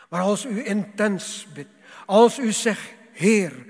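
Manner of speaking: rising to a full-throated shout; loud fervent preacher's cry; preaching shout. Acted. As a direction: cry it out from the pulpit.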